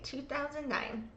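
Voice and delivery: ordinary delivery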